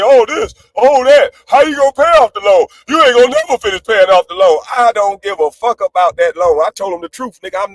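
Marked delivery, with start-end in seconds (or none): 0.0-4.6 raised, louder mock nagging; a loud, mocking imitation of chat critics; mocking the dummies. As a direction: parrot the nagging dummies with scorn. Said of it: "the dummies that always sit there and say".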